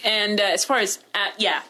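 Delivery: normal talking voice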